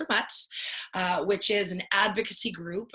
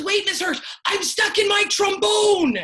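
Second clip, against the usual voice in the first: put-on high, loud young boy's plea; panicky kid; 12 semitones higher, 7 dB louder than usual. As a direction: whine like a stuck kid. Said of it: "And he goes".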